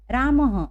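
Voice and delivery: रामः said as "raamah", short ह breathed at the end; visarga pronunciation. Shown as a demonstration. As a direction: model the visarga for children. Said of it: "विसर्ग लगा है". (रामः को "रामह" बोलना)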